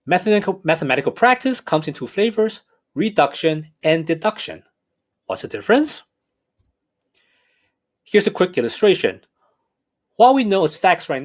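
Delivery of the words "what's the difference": usual speaking voice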